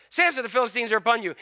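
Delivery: ordinary delivery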